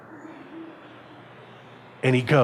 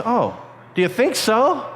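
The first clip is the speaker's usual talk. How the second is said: higher, slow, rising question; lightly voiced gullible friend; 7 semitones higher than usual. Flattered friend asking about the jump, lightly mimicked hopeful question. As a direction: play a friend falling for flattery.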